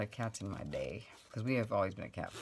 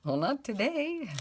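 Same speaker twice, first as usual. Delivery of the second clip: mom imitated; 6 dB louder, slower than usual. Mother reassuring child in story.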